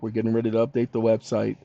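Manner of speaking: ordinary talk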